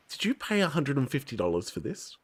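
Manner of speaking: hushed, low questioning; subdued retold incredulity; stunned disbelief. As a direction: ask it softly, as if barely believing it. Acts out stunned question to mum.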